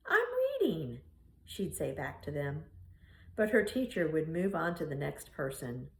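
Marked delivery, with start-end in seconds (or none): 0.1-1.0 put-on little girl voice. Little girl answers teasing kids.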